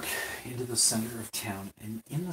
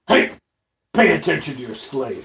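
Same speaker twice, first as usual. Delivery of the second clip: angry orc; 10 dB louder than usual. Acted. Game master voices an angry orc.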